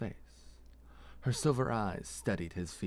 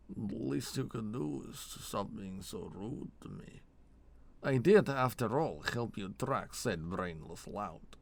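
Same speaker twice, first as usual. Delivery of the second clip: put-on huffy Elkexi-accented complaint; Elkexi accent, wounded; 2 semitones higher than usual. Vold asking his rude partner for thanks, Elkexi accent. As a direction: grumble with hurt dignity in an Elkexi accent.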